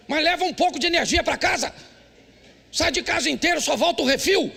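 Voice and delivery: high, pressing sermon voice; preaching. Acted. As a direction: drive the point home to the congregation. Preaching to husbands.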